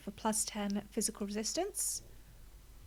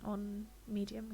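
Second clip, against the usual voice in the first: whispered aside; 8 dB quieter than usual. Real, not acted. Aside about difficulty.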